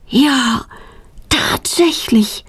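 mildly astonished, lingering delivery; amazed. A narrator's reading. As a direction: marvel at it, drawing the words out. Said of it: "staunt Claudia".

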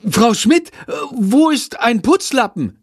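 high, agitated pupil asking for help. A narrator's reading. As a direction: ask urgently, voice jumping up high. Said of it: "fragt ein Mitschüler aufgeregt". (aufgeregt, mit hoher Stimme)

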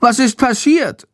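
high, alarmed little bat asking; worried bat demanding what happened; shocked. A narrator's reading. Shandor asks Jendrik about the dead bat, high and alarmed. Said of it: "ruft er entsetzt".

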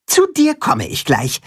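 high bossy hen giving orders; brisk, high instructions; high commanding hen. A narrator's reading. Katzenhuhn's high voice.

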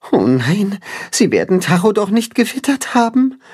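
fretting that the wolves smelled his friend; worried. Acted. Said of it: "befürchtet Timme".

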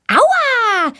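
very high ouch; cry of pain. A narrator's reading. Girl yelps when Tommy pulls her leg, very high. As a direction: yelp as he yanks her leg. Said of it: "schreit Valentina".

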